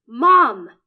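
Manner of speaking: huge, loud furious yell; roar. Acted. Son confronting his mother, roaring. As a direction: bellow the word at her. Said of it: "I roar".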